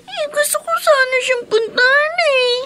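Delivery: tearful, sobbing through words; crying child. Acted. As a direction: speak while crying. (umiiyak na bata)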